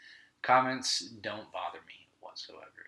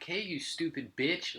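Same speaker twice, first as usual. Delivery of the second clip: mildly hammed-up mean greeting; mean retort; 7 semitones higher than usual. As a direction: spit it out slowly to put her down. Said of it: "I was mean as fuck to her".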